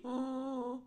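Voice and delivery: loud, long hee-haw noise; donkey bray. A narrator's reading. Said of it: "goes the donkey".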